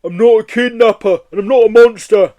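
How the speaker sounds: loud high protest; protesting Yeti. Acted. Yeti insisting to Joe it is innocent, loud high protest.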